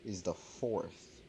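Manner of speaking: ordinary talk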